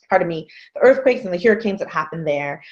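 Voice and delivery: ordinary talk, nothing marked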